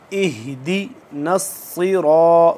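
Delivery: नस्सिरा with a heavy doubled स, slow; heavy drawn recitation. Shown as a demonstration. Teacher recites इहदी नस्सिरा for students, heavy and slow. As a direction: draw out a thick स in नस्सिरा. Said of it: "इसको हम खींच के पढ़ेंगे".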